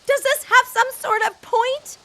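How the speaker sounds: sharp, high and loud retort; irritated. Acted. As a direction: bristle at him, demanding. Diana bristles at Tom.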